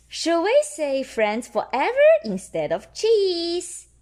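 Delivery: put-on high child voice asking the class; Zoe's voice. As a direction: suggest it brightly. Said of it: "Zoe Zebra says".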